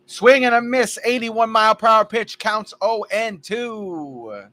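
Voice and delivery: lively baseball play-by-play; play-by-play call. Acted. Announcing his ballgame to friends, live broadcaster style. Commentates his video game.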